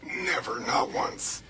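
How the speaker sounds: hoarse whisper; breathy, unvoiced words; whispery voice. Real, not acted. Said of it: "Excuse my voice".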